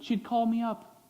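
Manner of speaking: normal talking voice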